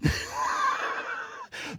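shrill, breathy high laughing shriek; burst of laughter. Real, not acted. Preacher laughing at Haman being named. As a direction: let out a big squealing laugh.

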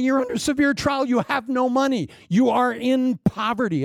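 high, pleading objection; Paul begging them to stop; Paul protesting. Retold or imitated.

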